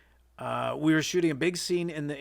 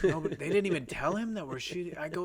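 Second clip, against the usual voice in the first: ordinary delivery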